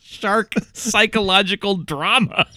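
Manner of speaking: dramatic announcer. Acted. Dramatic movie title announcement.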